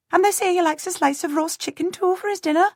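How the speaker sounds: put-on high, quick voice of a flustered peasant girl; a startled servant girl piping up to a lord.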